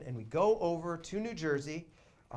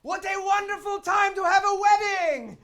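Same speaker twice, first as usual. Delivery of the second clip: loud, high, hammed-up cheerful shout; overjoyed lawyer; 11 dB louder than usual. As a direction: play the fake-happy lawyer announcing it. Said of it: "the lawyer's like".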